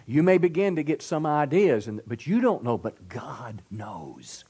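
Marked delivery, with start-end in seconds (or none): 2.8-4.4 whisper. Shown as a demonstration. Sermon point dropped to whisper.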